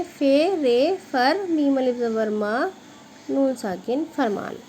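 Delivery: slow and deliberate, building the word; slow spelling out. Shown as a demonstration. Teacher spelling Farman for the class, slowly. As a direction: spell फे रे फर मीम अलिफ ज़बर मा syllable by syllable, slowly. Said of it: "इसको हम फरमान लिखेंगे".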